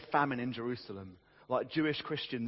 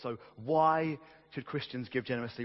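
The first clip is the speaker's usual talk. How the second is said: normal talking voice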